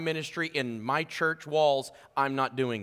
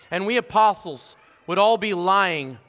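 scripture reading; 7 dB louder, slower than usual. Acted. Scripture read with weight.

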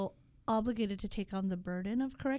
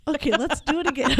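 laughing; 4 semitones higher, 8 dB louder than usual. Real, not acted. Cracking up after flub.

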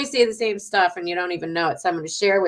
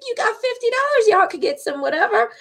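much higher breezy voice; self-quote to kids; 11 semitones higher than usual. Retold or imitated. Mother tells kids to spend their money, high breezy voice. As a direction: toss it off high and light. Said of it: "I'm like".

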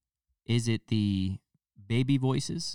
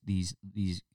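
ordinary talk

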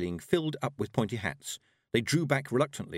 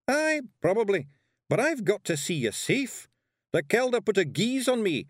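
high, broad Scottish brogue of a tiny warrior; Scottish pixie voice; 10 semitones higher, 4 dB louder than usual. Acted. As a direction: play the wee blue Pictsie, bold and cheeky. Rob Anybody's Scottish lines.

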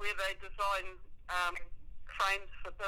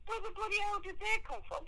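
imitating Mum; 12 semitones higher than usual. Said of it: "Mum's going".

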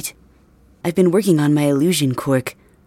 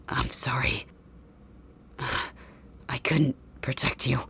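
soft, slow, breathless last words; fading out with his last strength; dying whisper; 3 semitones lower, 10 dB quieter, slower than usual. Acted. Dying boy apologizes to Deku.

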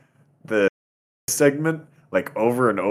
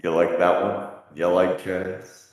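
big room echo; 6 semitones lower, 3 dB quieter than usual. Real, not acted. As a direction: voice echoing in a large hall. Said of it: "you sound like you're in a big room".

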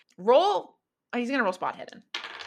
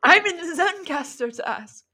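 much higher goofy voice; silly high voice; 10 semitones higher, 4 dB louder than usual. Pretend. GM repeating the joke, silly high voice. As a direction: squeak it out, joking.

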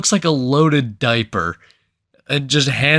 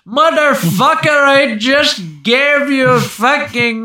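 high, loud mock scream of outrage; drawn-out, high-pitched hammed-up yell; outraged yell; 14 semitones higher, 7 dB louder than usual. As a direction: imitate an irate shopkeeper screaming from afar. Overheard shopkeeper yelling at returning beggar.

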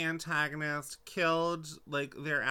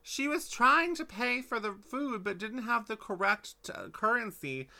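indignant protest; 6 semitones higher than usual. Real, not acted. Arguing back, defending.

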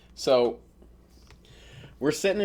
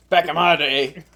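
higher, louder hammed-up repeat; mock old-timer cry; 4 semitones higher, 6 dB louder, slower than usual. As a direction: call it out like a self-mocking veteran. Mock repeat of own phrase.

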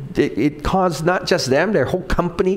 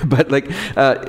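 ordinary delivery

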